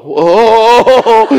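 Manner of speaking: a loud, high mock "Oh"; loud high mock cheer; excited ooh.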